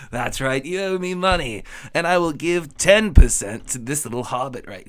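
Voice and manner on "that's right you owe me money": normal talking voice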